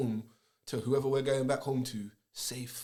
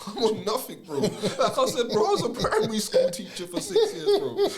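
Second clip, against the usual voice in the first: much higher and louder talk through a laugh; 10 semitones higher, 8 dB louder than usual. Real, not acted. Host laughs at his own tough-guy image, laughing hard. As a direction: crack up mid-sentence.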